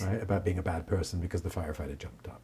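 soft, unvoiced murmur; whispered aside. Real, not acted. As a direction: trail off into a whisper.

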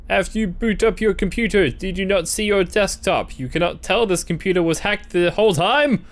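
ordinary talk

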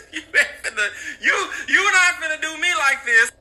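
an angry mom shouting at her son; mad mom yell. Retold or imitated. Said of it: "She's like".

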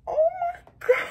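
high, loud gasp then oh my God; thrilled shriek of disbelief; gasping, squealing delight; excited gasp. Retold or imitated. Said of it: "I was like".

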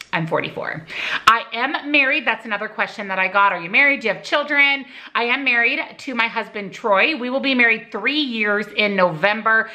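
normal talking voice

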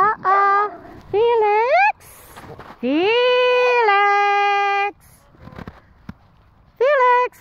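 high, loud coaxing call to the dogs; excited calling. Real, not acted. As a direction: sound thrilled to lure them over. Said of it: "every time I sound excited".

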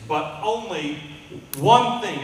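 "one" hit high and loud; stress on one. Acted. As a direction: punch the word "one". Preacher stresses one thing.

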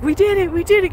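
a lightly imitated celebration of making it; excited cheering. Hikers at Steamboat geyser celebrating, mock excited cheer. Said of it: "They're like".

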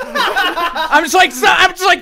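laughing high and slow. Real, not acted. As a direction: laugh through it. Laughing while retelling.

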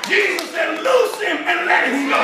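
full-throated preacher build; preaching at full force. Acted. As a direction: drive it home to the church with rising fire. Preaches the closing exhortation.

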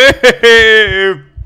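high, loud burst of laughter; triumphant cackle on finding it. Real, not acted. Finds what was hiding.

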